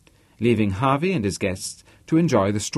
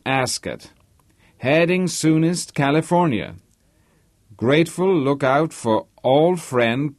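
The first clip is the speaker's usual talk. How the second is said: slow, higher-pitched American telegram reading; reading out aloud; 4 semitones higher than usual. Acted. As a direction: read a telegram aloud, phrase by phrase, slowly. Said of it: "Harvey read it out aloud".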